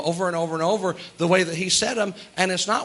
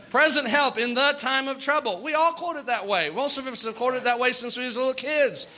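ordinary talk

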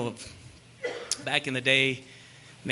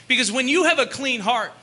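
raised, driving sermon delivery; emphatic preaching; 10 semitones higher, 5 dB louder than usual. Acted. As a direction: hammer out each condition to the congregation. Preaches on the verse.

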